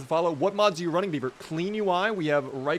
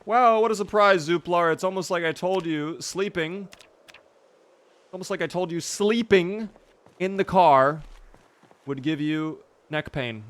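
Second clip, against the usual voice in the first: higher, louder mock surprise; sarcastic; 5 semitones higher, 5 dB louder than usual. Real, not acted. Player telling Zooplar off over neck pain, sarcastic. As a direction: rub in an I-told-you-so. Sarcastic I-told-you-so to character.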